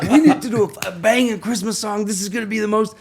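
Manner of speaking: a light, laughing impression of an excited caller. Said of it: "And he's like".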